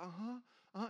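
very soft murmur; retold quiet motherly uh-huhs; patient listening mother. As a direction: murmur along soothingly.

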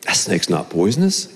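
soft, low under-the-breath mutter; muttered to himself. Retold or imitated. Boy quietly insisting to himself, soft low mutter. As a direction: say it half to yourself, low and soft. Muttering before talking out loud.

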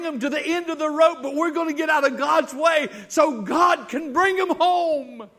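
starts loud and high, climbs to a full shout; building to a preaching shout. Acted. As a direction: build the appeal until you're shouting.

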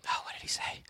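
whispered aside to yourself; hushed, barely voiced muttering; thinking aloud. Real, not acted. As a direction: drop to a whisper, trying to recall. Aside to himself while recalling.

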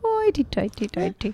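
high, airy mock-Irish lilt; playful fake Irish accent. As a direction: toss it off in a put-on Irish voice. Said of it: "There's the Irish go".

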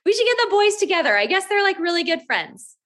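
high, bubbly friendly mom voice; imitating a sugary-sweet stranger on Facebook; mother of the other boy apologizing, high and bubbly; chirpy stranger mom. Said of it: "and she said".

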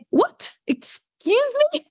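high-pitched, incredulous retold reaction; shocked disbelief. Retold shocked reply to prediction.

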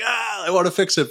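high, pained "ah", itching to step in; mock exasperation at watching someone fail; frustrated inner voice. Former sales manager voicing his urge to fix a rep's mistake, high exasperated whine. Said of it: "And you're just like".

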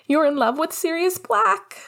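put-on high, excited child's blurt; bursting out. A narrator's reading. Little brother bursts out the secret on FaceTime, high-pitched. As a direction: blurt it out in a rising kid voice. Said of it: "Julian burst out".

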